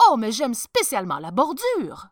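loud, admiring gush; feigned enthusiasm. A narrator's reading. Archbishop admiring cloth he cannot see, enthusiastic. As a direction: hide your doubt behind loud praise. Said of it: "tous admirèrent l'étoffe avec enthousiasme".

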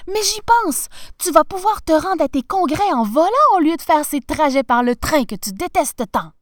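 high, loud rush of enthusiasm; elated, starting high and easing slightly; excited wife. A narrator's reading. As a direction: burst out with a thrilling idea. Thrilled wife has an idea.

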